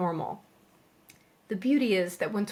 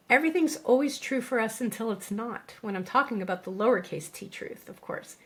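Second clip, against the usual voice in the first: ordinary delivery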